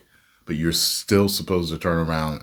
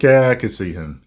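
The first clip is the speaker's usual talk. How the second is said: higher, louder "yeah, I can see him"; mock casting director; 6 semitones higher, 8 dB louder than usual. Mocking Hollywood casting a vague actor, upbeat mock voice. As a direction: play the eager casting director. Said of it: "it's like".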